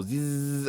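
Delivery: buzzing "zzz" of an engine; motorcycle zoom noise. Shown as a demonstration. Rider weaving down the middle, buzzing engine noise. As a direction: zip past with a buzzed zzz.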